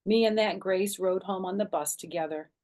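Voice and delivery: ordinary delivery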